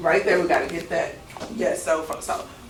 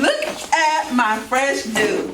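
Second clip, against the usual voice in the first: slow showing-off line; 4 semitones higher, much slower than usual. Shown as a demonstration. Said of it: "You see how I did it?".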